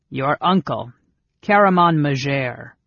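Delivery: firm, dignified introduction; stately, emphatic reply; with dignity. A narrator's reading. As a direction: announce yourself with dignity. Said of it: "replied with dignity".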